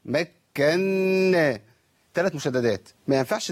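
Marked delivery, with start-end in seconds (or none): 0.1-1.6 "مكنا" slowly, with a long hum on the nun; doubled nun. Shown as a demonstration. Teacher isolating the third shadda, hummed nun. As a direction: hold the n of "مكنا" through the nose. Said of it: "نون مشددة".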